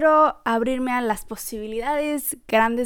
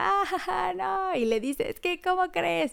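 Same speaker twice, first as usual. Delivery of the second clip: high, excited mock squeal of delight; a light imitation of someone shrieking with joy; excited shouting; 8 semitones higher, 4 dB quieter than usual. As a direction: play an overexcited friend, pitched up.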